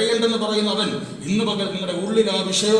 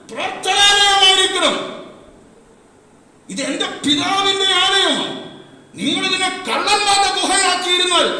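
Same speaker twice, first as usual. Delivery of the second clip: raised, louder voice as Jesus rebuking the temple; Jesus quoted forcefully; 10 dB louder than usual. Retold or imitated. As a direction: proclaim Jesus's words with a shout. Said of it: "യേശു ആ പറഞ്ഞതിൻ്റെ". (യേശുവിന്റെ വാക്കുകൾ ഉറക്കെ ഉദ്ഘോഷിക്കുന്നു)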